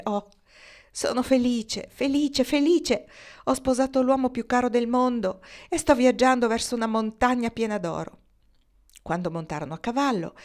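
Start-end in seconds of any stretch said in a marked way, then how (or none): 0.0-8.2 overjoyed. Acted. Bride waking, overjoyed after a kiss.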